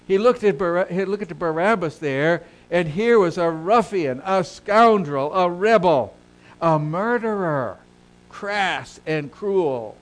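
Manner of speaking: loud, high preacher piling up the words; impassioned, emphatic sermon delivery; forceful preaching. Real, not acted. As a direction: hammer out each noun from the pulpit.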